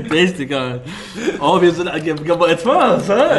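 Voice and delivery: loud, laughing through the words; breaking into laughter mid-sentence; after laughing hard. Real, not acted. Cracking up over Crash joke.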